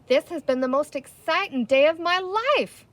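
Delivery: a loud, eager burst of words; blurting it out, thrilled and close to tears; blurted out. A narrator's reading. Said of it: "Captain Hook blurted out".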